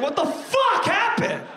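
high, drawn-out cry of disbelief; outraged yell. Acted. Customer whose number was skipped, yelling in disbelief. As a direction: wail it in mock outrage.

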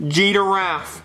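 "Giraffe" as low "jee-duh-raff"; "Giraffe" in three syllables, "jee-da-raff"; mispronounced giraffe. Acted. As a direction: put a D into "Giraffe". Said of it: "No, it's not giraffe".

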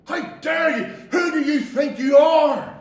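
loud, slow, furious defiance; cursing God. Retold or imitated. A preacher voices the damned cursing God, furious and loud. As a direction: spit the words at God in rage. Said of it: "they are actually cursing God".

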